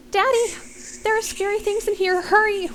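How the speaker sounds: put-on very high, loud frightened boy; child screaming. A narrator's reading. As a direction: scream for Daddy's help. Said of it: "Ryan screams out".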